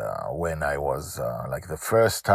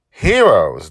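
loud, weighty Heroes; intense; 3 semitones higher, 13 dB louder than usual. Acted. Dragon Lil telling her father, slowly and with intensity. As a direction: lean hard on Heroes. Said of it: "said slowly with intensity".

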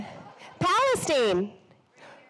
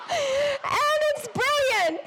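high, slow laughing exclamation; tickled, pushing the words out through laughter; much slower than usual. Real, not acted. Telling the audience about funny endorsements, laughing.

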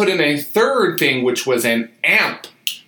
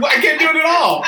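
laughing attempt at Kermit; 13 semitones higher than usual. Trying for Kermit voice.

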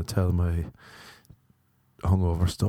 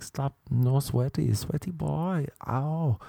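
higher, softer teasing coo; mock girl's coo; 5 semitones higher, 3 dB quieter, slower than usual. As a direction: coo sweaty boy like a mock lover. Girl teasing in retold scene.

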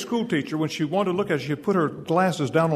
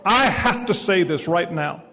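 loud, urgent retold plea; acted insistence, hitting the first word hard; intense insistence; 3 semitones higher, 6 dB louder, slower than usual. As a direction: insist forcefully, as if it cannot wait. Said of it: "she got real intense".

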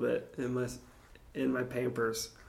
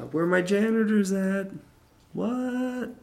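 high-pitched, louder mock hype call; playful shout-out; 8 semitones higher, 4 dB louder, slower than usual.

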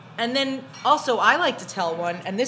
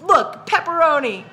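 triumphant show; 7 semitones higher, 6 dB louder, slower than usual. Retold or imitated. Showing customer the pepperoni bag.

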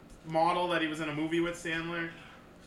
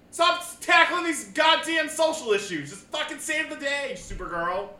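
higher, slow exasperated plea; fed-up rant; 8 semitones higher, 4 dB louder, slower than usual. Real, not acted. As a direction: beg the show to stop, fed up. Ranting at the show.

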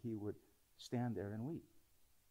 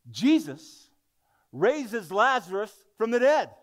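much higher, louder proclamation; preacher exulting at the miracle; triumphant; 10 semitones higher, 14 dB louder, slower than usual. Real, not acted. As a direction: announce the miracle with joy. Triumphant sermon climax.

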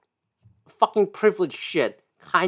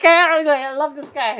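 higher, slow starstruck gush; mock fan; 9 semitones higher, slower than usual. Parodying a fan adoring Scott Adams, higher and slow. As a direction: swoon over him sarcastically. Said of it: "And then you're like".

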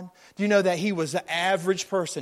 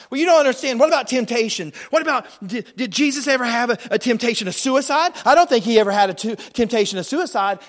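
louder, higher mock complaint of a doubter; indignant doubter; 6 semitones higher, 6 dB louder, faster than usual. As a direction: throw the objection like an upset skeptic. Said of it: "So you can be here and say".

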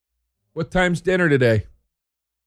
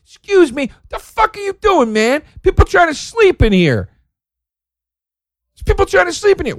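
pretend yell. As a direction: bark at them like a grumpy neighbour. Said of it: "I get to walk outside and go".

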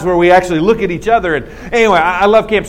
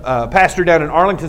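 ordinary talk

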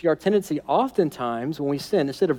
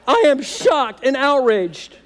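high, slow hammed-up indignation; mock outrage; 9 semitones higher, 4 dB louder, slower than usual. Preacher mocking Adam's fake indignation. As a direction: ham up fake shock.

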